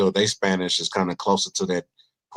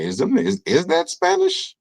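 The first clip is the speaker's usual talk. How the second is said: confused question. Retold or imitated. Mocking how thick Spanish sounds to him, baffled. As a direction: squeak it out in disbelief.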